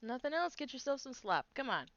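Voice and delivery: a lightly voiced camp cook, pushy; gruff cook voice. Camp cook urging travellers to eat, lightly voiced. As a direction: nudge them to grab some slop.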